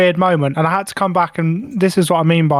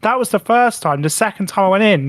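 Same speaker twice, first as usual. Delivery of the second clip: usual speaking voice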